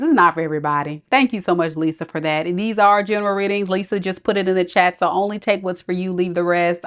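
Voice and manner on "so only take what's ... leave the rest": ordinary talk, nothing marked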